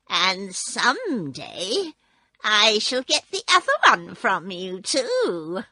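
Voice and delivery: slow, sneering witch threat; lightly mocking old witch. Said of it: "laughing at her".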